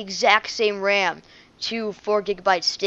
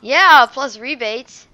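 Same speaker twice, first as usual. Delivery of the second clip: emphatic; 6 semitones higher, 12 dB louder, much slower than usual. Real, not acted. Confirming rebates, drawn out.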